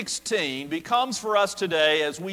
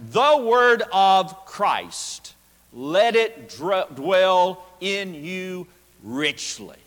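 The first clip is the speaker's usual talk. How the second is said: each word given weight, slowly; slow emphatic reading; 3 dB louder, slower than usual. Shown as a demonstration. Preacher reading the Greek translation aloud, slow and emphatic. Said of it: "A direct translation of the Greek text says this".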